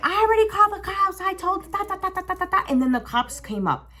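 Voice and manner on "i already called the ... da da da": angry telling-off. Retold or imitated. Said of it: "I was like".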